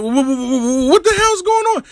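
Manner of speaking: high stuttering what-the-hell; high-pitched startled stammer; stammered confusion. Retold or imitated. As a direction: stutter up into a squeak of disbelief. Confused reaction to caller.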